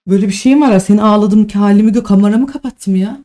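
loud, worked-up outburst; really aggrieved, voice pushed hard; upset protest. Real, not acted. Upset streamer protests the insults. (sinirli, yüksek sesle isyan)

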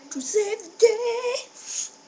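high, drawn-out heroic sing-out; mock hero. Vlogger announces himself to save the day, mock hero. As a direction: stretch it out high like an arriving superhero.

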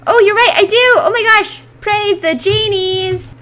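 high, loud joyful exclaim; relieved cheer. Real, not acted. Player relieved she has enough food, cheering. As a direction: celebrate the rescue.

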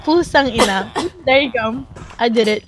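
ordinary delivery

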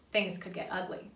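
usual speaking voice

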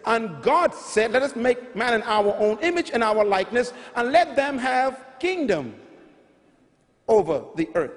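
usual speaking voice

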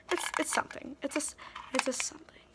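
hushed half-whispered joke; mock whisper. Vlogger jokes about the lip balm name, whispering. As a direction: whisper it like a silly secret.

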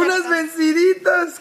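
high announcer cry; excited shout. Acted. Excited arm wrestle announce.

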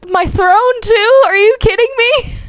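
incredulous and affronted; outraged disbelief. Real, not acted. Queen finding bees on her own throne, outraged.

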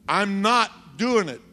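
high, loud, slow imitated refusal; defiant refusal. Sister refusing her boss's order, defiant. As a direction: dig in, stretching each word loudly.

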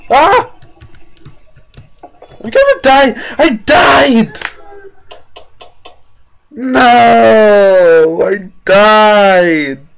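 loud high shriek; screaming. Real, not acted. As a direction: scream in despair. Character dies in game.

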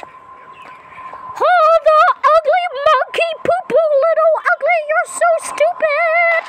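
high, slow put-on taunt; mock the player in a silly voice; taunting character voice. Game figure insults the kid, high slow taunting voice.

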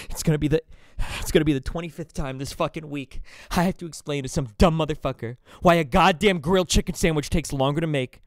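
loud fed-up server; frustrated rant. Retold or imitated. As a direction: vent it with a sigh through gritted teeth. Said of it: "and you're like".

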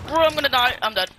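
panicked yell. Real, not acted. About to die in match.

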